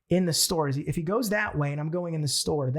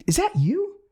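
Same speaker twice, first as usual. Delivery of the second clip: much higher, louder astonished question; surprised realization; 13 semitones higher, 4 dB louder, slower than usual. Retold or imitated. The stranger realizing the speaker is the famous guy, high and astonished. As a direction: gasp it out as it dawns on him. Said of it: "and he go".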